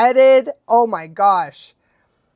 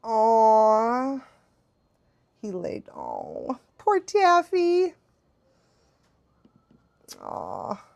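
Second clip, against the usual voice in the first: soft, drawn-out sad aww; slow, tender pity for a poor thing; sad sympathy; 5 dB quieter, much slower than usual. Real, not acted. Streamer feeling sorry for poor Taffy, sad. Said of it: "I went from, like, sad to, like, laughing".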